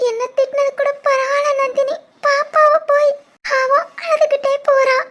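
hurt and close to sobbing; tearful. Acted. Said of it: "விடுடி, அழாத".

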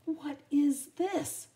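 slow loud indignant demand. Acted. Aunt Sarah demanding from Lady, slow and loud. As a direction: demand an answer. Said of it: "she demanded".

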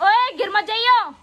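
stretched-out shout of warning; called-out warning. Real, not acted. Warning child not to fall.

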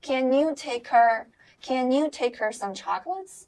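slow CAN YOU TAKE HER with "take-er" linked; linked together. Shown as a demonstration. Teacher models the sentence for learners, linked together. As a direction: read slowly, dropping the H of HER. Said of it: "连到一起".

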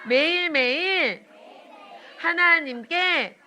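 slow prayer, word by word, pausing; children's prayer. Acted. Teacher leading children in prayer, slow and earnest. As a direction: lead the children through each phrase slowly.

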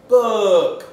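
"book" blended into one loud, high word; triumphant, joined-up "book". Shown as a demonstration. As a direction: call out "book" whole, stretched and falling. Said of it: "for you to blend them together".

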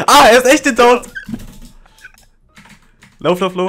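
high panicked laughing yell; being chased. Real, not acted. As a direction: scream while running from the killer. Chased by murderer in game.

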